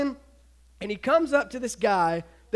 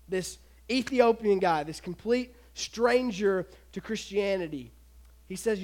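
normal talking voice

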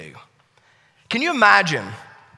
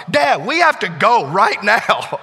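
worried; 2 semitones higher, 6 dB louder, slower than usual. Retold or imitated. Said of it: "he is so worried".

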